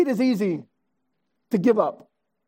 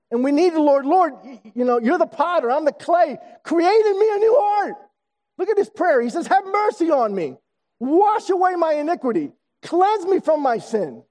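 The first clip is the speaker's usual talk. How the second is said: fervent preaching; 7 semitones higher than usual. Acted. Pastor voicing David's plea to God, fervent and high.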